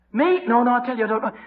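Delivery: high-pitched, hurried protest; hammed-up nervous innocence; flustered denial. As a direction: brush off the accusation, voice jumping up. Acted Peter denying again.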